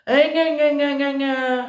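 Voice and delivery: mock her mother in a singsong whine; teen mimicking her nagging mother; mocking gna-gna. Daughter mocks her mother.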